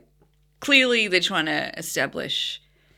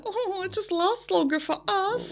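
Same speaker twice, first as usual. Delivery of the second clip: much higher, lightly acted embarrassment; mock embarrassed. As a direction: play her sheepish, pitched right up. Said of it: "like, all embarrassed 'cause she's like".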